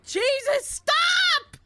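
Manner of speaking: sudden high yell; panicked shout. Real, not acted. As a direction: yell for it to stop. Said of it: "How do I stop it?".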